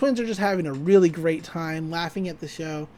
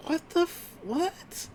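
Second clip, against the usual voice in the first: shocked Mabel voice; 10 semitones higher, 8 dB quieter, slower than usual. Retold or imitated.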